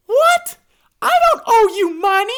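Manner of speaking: confused protest. A narrator's reading. Hippo to badger, high confused protest. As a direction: protest in disbelief. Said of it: "Confused as to what is happening".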